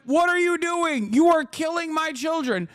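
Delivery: high outraged cry; imitating furious citizens; mock outraged public. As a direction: plead in a high, frantic voice. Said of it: "Everybody went".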